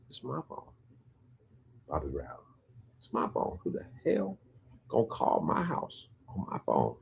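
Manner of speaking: hushed, disbelieving grumble to himself; baffled grumble. Retold or imitated. Bobby puzzling over who calls his phone, quiet indignant grumble.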